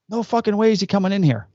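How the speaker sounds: high-pitched imitation of an outraged old man; indignant refusal. Old father refuses to take the kid in, high mock indignation.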